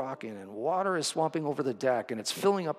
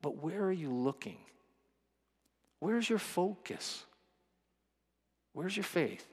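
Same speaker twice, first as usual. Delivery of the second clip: soft, probing Jesus; 4 semitones higher, 4 dB quieter than usual. Retold or imitated. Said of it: "And Jesus answers".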